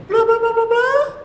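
very high mock babble; sing-song falsetto imitation of her talking; mimicking the woman. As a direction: squeal out her chatter as gibberish. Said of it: "she say".

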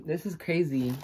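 ordinary talk

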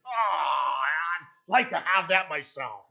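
slow, longing sigh of wanting it, whiny and drawn out; a hint of a covetous pastor, milked for effect; mock envious pastor. Preacher mimicking pastors who covet the big church building, slow and longing.

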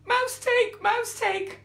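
very high, loud, incredulous cry; outraged lioness. A narrator's reading. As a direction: shriek it back in outrage. Lioness outraged at the mouse's word.

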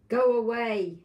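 loud stern cat telling off; big cat. Acted. Grandma reading, big cat shoos kitten, loud stern voice. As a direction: shoo the kitten loudly. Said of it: "said a big cat".

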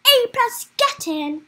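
high, loud burst of triumph; excited cheer. Real, not acted. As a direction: celebrate a top mark, loud and high. Celebrates getting top marks in game.

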